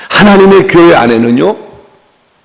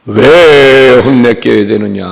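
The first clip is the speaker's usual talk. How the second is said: low, slow, weighty lord's voice; Jesus's voice; 5 semitones lower, much slower than usual. Shown as a demonstration. Preacher showing how Jesus should sound, low and slow. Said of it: "예수님 목소리가 뭐 이 정도는".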